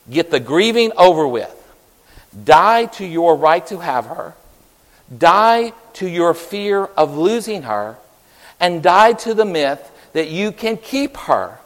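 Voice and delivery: preacher's stern counsel. Retold or imitated. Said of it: "the preacher said".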